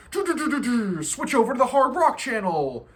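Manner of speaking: loud, high hammed-up radio ad with a laugh; mock rock ad. Mocking a hard rock station advertising a switch. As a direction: belt it like a cheesy rock station promo. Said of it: "the hard rock station will advertise".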